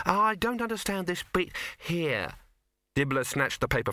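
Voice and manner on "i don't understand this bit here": uneasy artist. A narrator's reading. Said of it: "who had been hovering uneasily".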